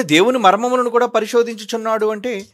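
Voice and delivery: ordinary talk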